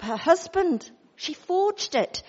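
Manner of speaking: ordinary delivery, nothing marked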